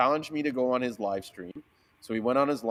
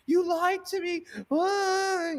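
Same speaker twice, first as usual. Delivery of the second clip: high mocking girl voice; squeaky mock of the angry customer; mocking her; much slower than usual. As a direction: mimic her whiny complaint in a much higher pitch. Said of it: "she calls me back up".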